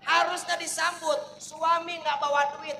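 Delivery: ordinary talk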